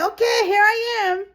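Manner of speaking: high, loud eager mock reply. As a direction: burst in like a keen newcomer. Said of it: "And you say".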